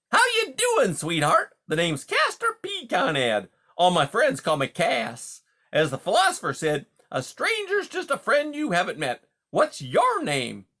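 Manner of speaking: high, loud, hearty salesman-like patter; brash, chummy beaver. Acted. Beaver greets a wary mouse as a new pal, brash and chummy. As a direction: play a pushy, overfamiliar beaver, loud and high. Beaver character introduces himself.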